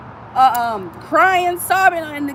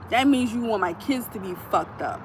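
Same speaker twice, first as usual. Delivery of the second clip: ordinary talk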